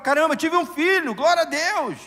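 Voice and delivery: loud, high-pitched thanksgiving; alegria exaltada. Acted. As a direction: exclaim it like overjoyed, grateful news. Voices a grateful new mother rejoicing. (exultante, em voz alta e aguda)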